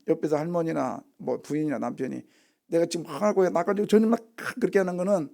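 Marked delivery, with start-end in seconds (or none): none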